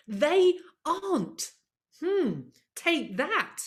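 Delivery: slow, sassy mic-drop; defiant. Real, not acted. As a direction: savour the point and dare them to argue. Defiant punchline to audience.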